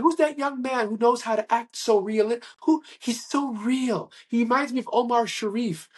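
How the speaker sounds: high, gushing mock praise; retold admiring voice. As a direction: gush it in a high, put-on voice. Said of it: "She said".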